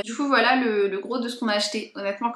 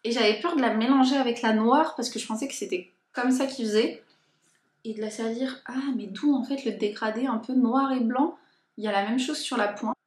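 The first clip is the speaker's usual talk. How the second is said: ordinary talk, nothing marked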